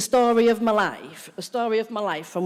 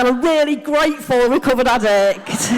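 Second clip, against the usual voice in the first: excited; 3 semitones higher, 6 dB louder than usual. Acted. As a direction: burst onto stage thrilled to be here. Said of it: "I'm so excited".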